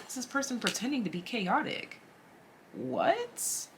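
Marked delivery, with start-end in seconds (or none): 2.7-3.7 much higher, squeaky disbelief; a shocked, squeaky question; disbelieving squeak. Real, not acted. Reacting in disbelief to a card.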